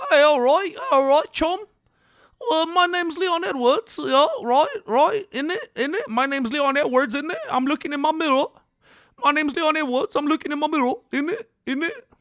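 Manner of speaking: high, loud hammed-up British accent; a mock Brit hyping himself in the mirror. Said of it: "That's how British people sound to me".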